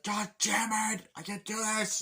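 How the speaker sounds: high, slow loud anguish; mock anguished god damn it; despairing outburst. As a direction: wail it in despair.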